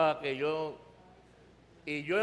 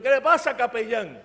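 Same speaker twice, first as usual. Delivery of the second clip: loud, higher challenge, easing at the end; retold raised-voice sergeant's query; lightly voiced sergeant; 4 semitones higher, 7 dB louder than usual. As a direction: question the chaplain like a sergeant. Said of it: "Y él dijo".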